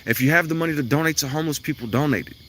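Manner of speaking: ordinary talk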